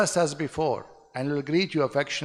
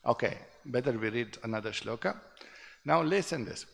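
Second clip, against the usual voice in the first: usual speaking voice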